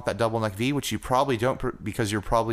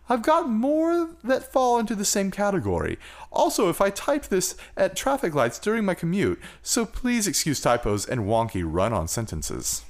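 lightly put-on higher spaceman voice, reading a letter; a hint of a dreamy spaceman; moon voice; 8 semitones higher than usual. Host reads a listener's letter for fun in a light, higher moon voice. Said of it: "I think that was the moon voice".

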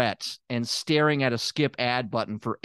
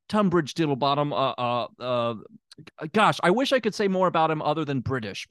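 usual speaking voice

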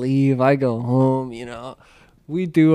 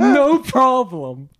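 laughing; 9 semitones higher, much slower than usual. Retold or imitated. Brother's reply retold through laughter.

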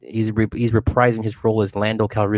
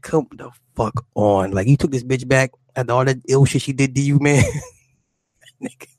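ordinary talk, nothing marked

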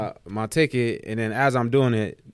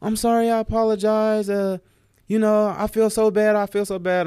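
high-pitched mock-remorseful lady; exaggerated sorry-woman impression; imitated apology; 9 semitones higher, 3 dB louder than usual. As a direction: put on her pleading high voice.

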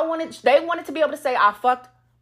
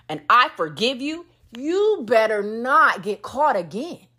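normal talking voice

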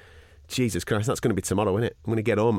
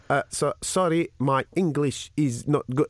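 slow, halting broken-English imitation; careful word-by-word foreign-accented English; 2 semitones higher, slower than usual. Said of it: "a conversation in broken English".

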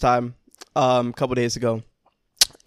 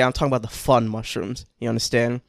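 ordinary talk

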